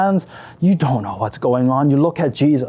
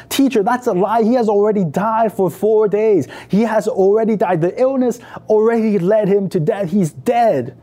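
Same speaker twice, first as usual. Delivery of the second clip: higher-pitched, insistent grieving objection; distraught protest; 6 semitones higher than usual. Acted. Mary contradicts the teacher about her dead brother, distraught protest. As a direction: argue it urgently, pitched up, pressing each point.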